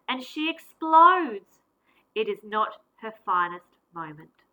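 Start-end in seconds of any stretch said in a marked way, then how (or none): 0.1-1.6 higher, loud and slow; a loud, slow outburst; explosive burst. A narrator's reading. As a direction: let the words blow up like her temper. Narrating her angry outburst.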